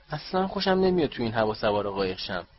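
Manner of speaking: ordinary delivery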